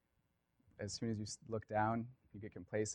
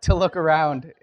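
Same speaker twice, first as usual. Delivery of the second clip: laughing; 7 semitones higher, 17 dB louder than usual. Real, not acted.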